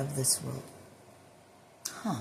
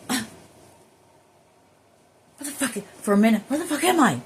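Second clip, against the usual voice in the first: higher, louder, fast mock bewildered outburst; imitating confusion; 7 semitones higher, 5 dB louder, faster than usual. Imitating her own confused self on returning to her body, mock bewildered. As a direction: act out waking up lost and rattled. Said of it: "when you're like".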